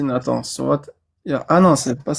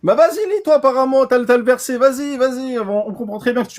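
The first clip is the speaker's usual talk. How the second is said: exasperated taunt; 8 semitones higher than usual. Real, not acted. Taunting opponent to read himself.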